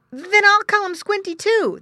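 put-on very high, loud child voice; excited boy. Boy naming his pig, excited. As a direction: declare it with boyish delight.

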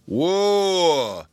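loud, high call to horses; yelling whoa. A narrator's reading. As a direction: holler it at the team. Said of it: "yelled the toyman".

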